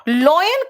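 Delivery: stressed "loy-en" for लॉयन; puzzled quote of others' लॉयन; mispronunciation लॉयन. Retold or imitated. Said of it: "कुछ लोग लॉयन बोलते हैं". (लॉयन, गलत "ऑय" पर ज़ोर)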